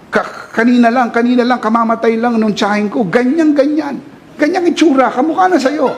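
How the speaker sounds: high, excited alarmist friend voice; panicky gossip. Retold or imitated. Preacher mocks a nervous friend, high panicky alarm. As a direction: squeal it like a nervous busybody.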